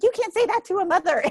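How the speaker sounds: imitating the indignant mother-in-law. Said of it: "She's like".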